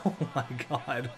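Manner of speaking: breaks into laughter mid-sentence; a real laugh cutting off the call. Real, not acted. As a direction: laugh through the words. Laughs at a player.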